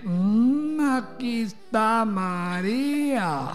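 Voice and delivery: very slow, soft groggy drawl; Hilda, dragging with laziness. Retold or imitated. Hilda hands over the salt with laziness, mock sleepy drawl. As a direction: imitate a sluggard barely able to answer. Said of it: "así con una pereza".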